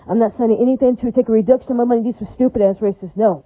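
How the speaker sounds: ordinary talk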